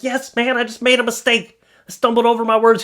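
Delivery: high-pitched defensive protest; exasperated retort. Retold or imitated. To a friend doubting him, exasperated. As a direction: imitate yourself snapping back, high and insistent.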